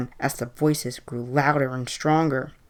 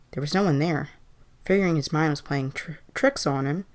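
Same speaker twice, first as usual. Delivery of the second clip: usual speaking voice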